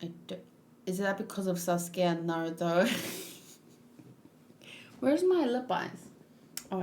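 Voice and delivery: normal talking voice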